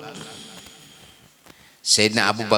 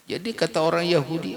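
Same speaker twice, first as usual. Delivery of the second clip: ordinary talk, nothing marked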